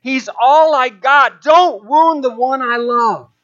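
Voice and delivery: slow, high, loud pleading; impassioned plea. Retold or imitated. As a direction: plead as if for someone you love. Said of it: "continued her impassioned plea".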